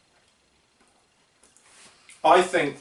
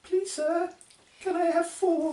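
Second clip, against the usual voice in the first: very high, small begging voice; pleading urchin voice; 6 dB quieter, much slower than usual. Pretend. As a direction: beg like a poor orphan. Begging like Oliver Twist.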